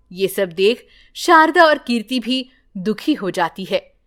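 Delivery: plain reading voice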